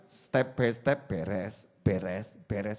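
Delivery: slow fading. Shown as a demonstration.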